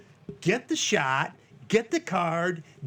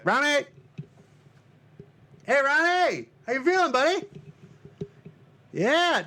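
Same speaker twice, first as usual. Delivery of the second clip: calling out; 11 semitones higher than usual. Real, not acted. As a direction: hail your buddy across the room. Calling out to slumping friend.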